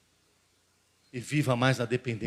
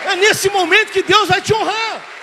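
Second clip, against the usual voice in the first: "nesse" cried out higher and louder still; peak preaching shout; 13 dB louder than usual. Acted. Pastor repeats the promise to the congregation, at a full roaring peak. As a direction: push the repeat to the very top of the voice, hitting "nesse". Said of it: "repeated, at peak".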